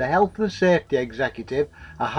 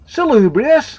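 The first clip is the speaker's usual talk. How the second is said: high, loud questioning echo; incredulous blurt; 10 semitones higher, 6 dB louder than usual. A narrator's reading. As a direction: blurt the word back in disbelief. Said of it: "blurted Sean".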